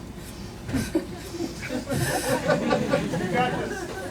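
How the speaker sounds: a shaky, airy laugh that breaks the reading; laughing through the emotion; breathless laugh. Real, not acted. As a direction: let a helpless laugh escape. Overwhelmed laugh after reading letter.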